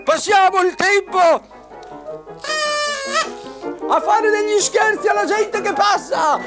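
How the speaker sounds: very high shouting puppet; squeaky shrill puppet voice. Acted. Auguste the minister puppet.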